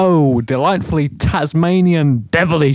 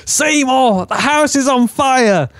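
mother calling; 8 semitones higher than usual. Acted.

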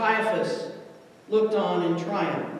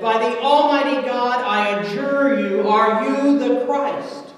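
frantic, raised voice, higher and much louder; 5 semitones higher, 8 dB louder than usual. A narrator's reading. High priest demanding an answer from Jesus, frantic. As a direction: press the demand loudly and high. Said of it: "the frantic Caiaphas".

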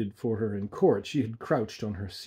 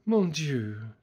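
sighing; 4 semitones higher than usual. Acted.

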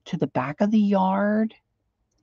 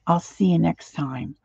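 usual speaking voice

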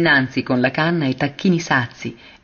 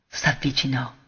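quietly voiced, softer than the narration; a hushed echo of the word; soft murmur; 6 dB quieter than usual. A narrator's reading. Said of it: "trattenendo il respiro".

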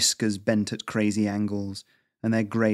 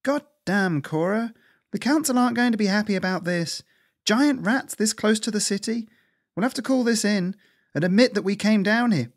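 higher, exasperated scolding squirrel; 11 semitones higher, 3 dB louder than usual. Acted. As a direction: scold a friend, rising alarm. Lisa scolds her friend.

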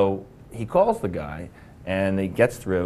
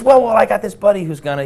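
higher louder buddy voice; voicing buddy; 10 semitones higher, 11 dB louder than usual. Friend pitching the job, higher and louder. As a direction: lightly put on the eager friend.

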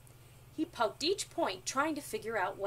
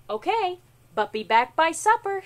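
very loud, higher motherly call; yelling back; 6 semitones higher, 13 dB louder than usual. A narrator's reading. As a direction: holler a reply across the house. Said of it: "she yelled back".

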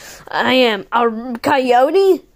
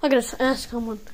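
usual speaking voice

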